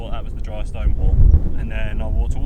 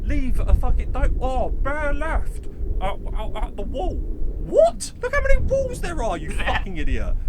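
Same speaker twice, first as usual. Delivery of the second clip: high, incredulous yelling; fed up, mocking the instructions; real exasperation peaking high; exasperated outburst; 12 semitones higher, slower than usual. Real, not acted.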